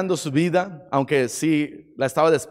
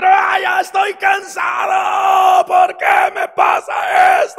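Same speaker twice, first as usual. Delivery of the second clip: high, loud, drawn-out outcry; a tired man screaming in a cave; frustrated yell; 13 semitones higher, 5 dB louder, slower than usual. Acted. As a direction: shout it to the sky, worn out. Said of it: "encontrar un lugar y gritar".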